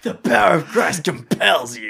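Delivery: loud high booming call; through a megaphone. Pretend. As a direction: boom it out like a police megaphone. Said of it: "He's got a megaphone".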